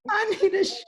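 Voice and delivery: very high, hammed-up plea; mock desperate wail. Friend joins the bathroom begging, high mock wail. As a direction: squeal it in pretend desperation.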